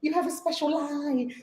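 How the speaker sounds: soft and slow, sweetly polite; lovely greeting. Retold or imitated. Airline staff greeting a first class flyer, soft and polite. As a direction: welcome them like a gracious host. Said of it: "they treat you lovely".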